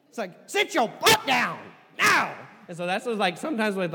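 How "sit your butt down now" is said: loud, slow commanding voice; with authority. Shown as a demonstration. Parent ordering kids to sit, stern and loud. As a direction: order them down firmly. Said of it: "with authority".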